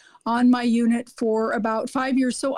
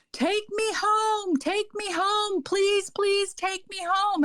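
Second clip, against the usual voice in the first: imitating a calling patient; 8 semitones higher, slower than usual. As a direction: imitate a far-off plea, stretching each word high. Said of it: "all of a sudden I hear again".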